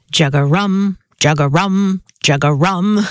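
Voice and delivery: low, repeated frog croak in words; bullfrog call. Retold or imitated. Host showing what kids are taught, imitated bullfrog call. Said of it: "the sound a bullfrog makes".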